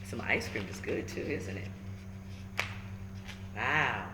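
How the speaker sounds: plain reading voice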